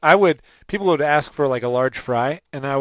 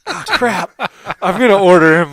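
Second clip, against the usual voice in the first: higher, laughing, half-panicked; laughing mock panic; 5 semitones higher, 7 dB louder than usual. A man realising the stranger has his name, laughing in mock panic. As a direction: fake panic through laughter.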